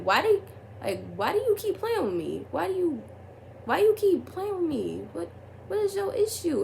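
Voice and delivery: high, pleading whine of frustration; demanding why he keeps playing her; exasperated pleading. Asking the boy why he plays with her, high and pleading. Confronting him in retold texts.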